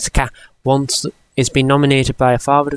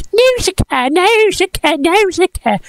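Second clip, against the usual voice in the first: Nausicaa said very high as "noo-sha-keh"; frantic Cat in the Hat. Retold or imitated. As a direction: squeak it like a panicking cartoon cat. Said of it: "Cat in the Hat is having a flippin' heart attack".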